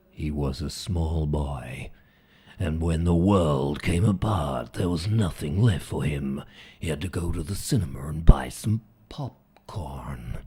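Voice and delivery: deep, dramatic whispery voiceover; deep-voiced film trailer man. Shown as a demonstration. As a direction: intone it like an apocalyptic film trailer. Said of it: "the deep-voiced man always".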